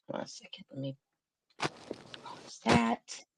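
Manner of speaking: whispered, very soft aside to herself; soft whisper while fiddling with the video. Real, not acted. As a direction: whisper it under the breath. Aside while pausing the clip.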